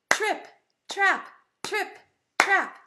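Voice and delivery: high, crisp, separated beats; hoofbeat imitation. Shown as a demonstration. Teacher showing goat's hoofs on the bridge, rhythmic imitation. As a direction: tap out each word like hooves. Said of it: "they went like this".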